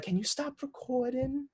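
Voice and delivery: high, slow imitation of an irritated grandma; grandma's annoyed request. Granddaughter imitates grandma annoyed at being filmed, high and slow.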